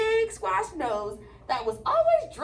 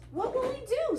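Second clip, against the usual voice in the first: high worried little goat voice; 10 semitones higher than usual. Acted. Teacher reading the middle goat's line, high and worried. Goat voice in read-aloud story.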